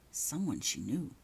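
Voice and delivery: usual speaking voice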